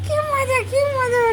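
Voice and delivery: very high-pitched delighted squeal; squealing with shared glee; gleeful pet talk. Pretend. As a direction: cheer for the dog in a high baby voice. Dog excited as dad arrives.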